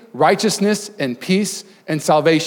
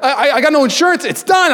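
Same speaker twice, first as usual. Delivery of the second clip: panicked; 10 semitones higher, 4 dB louder than usual. Retold or imitated. Panicking after phone breaks.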